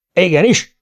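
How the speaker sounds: high, loud shouted yes-sir; kiáltás. A narrator's reading. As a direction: shout it like an obedient underling. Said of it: "kiáltott a Kapa". (magas, hangos kiáltás)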